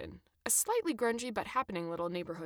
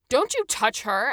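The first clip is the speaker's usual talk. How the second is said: loud, slow, protective yell; yelling back; 5 semitones higher, 10 dB louder, slower than usual. A narrator's reading. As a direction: yell it to defend your wife. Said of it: "Adam yelled back".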